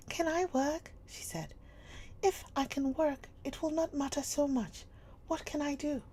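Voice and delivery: put-on eager girl. A narrator's reading. Sarah asking Miss Minchin for work, high and eager. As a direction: jump at the chance to work.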